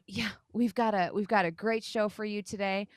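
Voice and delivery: ordinary delivery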